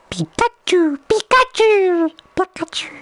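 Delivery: very high-pitched Pikachu impression; high Pokemon cry of its own name. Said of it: "The Pikachu I do".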